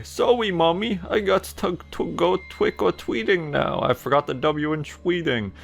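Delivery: put-on high, sing-song kid; sweet child voice. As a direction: pitch up like a little child. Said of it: "You say".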